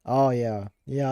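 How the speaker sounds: ordinary talk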